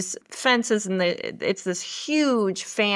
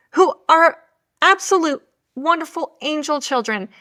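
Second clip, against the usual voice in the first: ordinary talk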